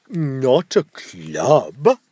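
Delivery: very slow, innocent ogre. Acted. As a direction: play the sly ogre. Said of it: "said the ogre".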